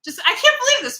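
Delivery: squealing I can't believe this; delighted disbelief. Real, not acted. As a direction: pitch jumps way up in happy surprise. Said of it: "People are actually leaving nice chat comments".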